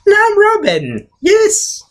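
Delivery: very high, squealed triumph; hammed-up high-pitched boyish glee; excited Robin. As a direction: celebrate like a thrilled kid sidekick. Voicing the boy becoming Robin.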